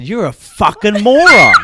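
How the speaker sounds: dad yelling back at the sent-off player, furious; furious yelled insult. Retold or imitated. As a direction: shout the insult straight up into his face. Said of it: "He said".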